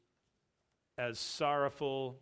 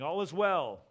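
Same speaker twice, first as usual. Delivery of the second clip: higher, louder announcement to the king; crying out; 4 semitones higher, 7 dB louder than usual. A narrator's reading. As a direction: call it out as good news. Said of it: "cried out to the king".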